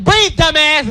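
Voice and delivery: loud barked order; shouting at a hyperventilating child; yelling at a crying kid. Retold or imitated. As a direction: snap at the kid to breathe.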